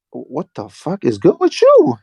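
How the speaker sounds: slow, high "what is up with you" reaction; retold disbelief. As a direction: stretch it out in mock bewilderment. Retelling their shocked reaction to scream.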